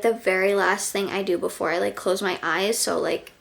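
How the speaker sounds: normal talking voice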